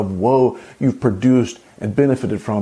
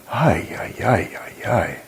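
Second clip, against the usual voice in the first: dismayed groan; 3 semitones lower, 3 dB quieter, slower than usual. Real, not acted. Groan of disbelief.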